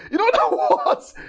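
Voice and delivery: words broken by real laughter; laughing. Real, not acted. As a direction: laugh through the phrase as you go on. Preacher laughs mid-phrase.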